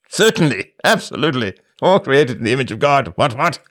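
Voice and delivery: high-pitched, pompous British colonial bluster; pompous British imperialist. Acted. Host lampooning colonial rule, in pompous British accent. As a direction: pontificate as a hammed-up British empire relic. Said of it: "[name]'s nineteenth century British pompous accent".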